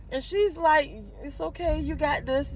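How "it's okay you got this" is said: soft, gentle retold reassurance; quietly soothe, imitating a supportive therapist; reassuring.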